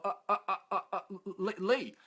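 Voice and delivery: stuck on repeated uhs before getting the name out; flustered stammer. Retold or imitated. Tongue-tied man giving his name to the woman he fancies, flustered stammer. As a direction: imitate a tongue-tied, stumbling introduction. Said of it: "I'm stumbling".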